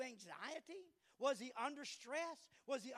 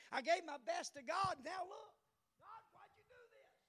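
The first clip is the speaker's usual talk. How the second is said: anguished cry to God; 7 semitones higher than usual. Acted. Job crying out to God.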